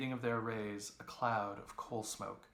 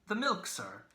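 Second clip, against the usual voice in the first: put-on old milk woman; 9 semitones higher, 6 dB louder than usual. A narrator's reading.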